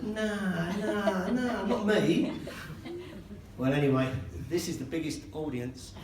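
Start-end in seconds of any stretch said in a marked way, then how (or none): 0.0-2.3 high, drawn-out "nah, nah"; mock denial. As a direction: stretch each refusal in comic disbelief, higher. Acted disbelief at being singled out.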